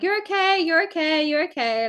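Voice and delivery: high, insistent repeated reassuring; urgent reassurance. Retold or imitated. Bystander to woman coming round from a faint, urgent reassurance. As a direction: keep urgently soothing someone who just fainted.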